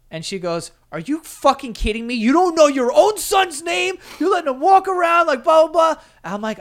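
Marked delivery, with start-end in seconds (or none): 0.9-6.0 high, loud indignant rant; retold mom blowing up in disbelief; outraged mother. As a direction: scold at full pitch, incredulous. Mother outraged at forgotten name.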